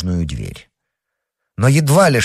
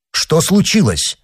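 higher-pitched, urgent question; urgent exclamation; 8 semitones higher, 4 dB louder than usual. A narrator's reading. Young man hurrying into the room, urgent exclamation. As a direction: exclaim it on rushing in. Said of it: "воскликнул, поспешно входя в комнату".

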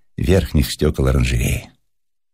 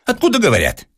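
much higher, exasperated demand; irritated. A narrator's reading. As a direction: demand an answer from the caller. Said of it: "раздражился Персиков". (раздражённо, на повышенном тоне)

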